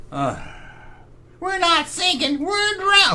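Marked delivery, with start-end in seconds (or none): 1.4-3.1 high put-on movie voice quote; quoting Temple of Doom. Streamer quoting a Temple of Doom line, high put-on voice. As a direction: squeak the line like the film character. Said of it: "nobody quotes that".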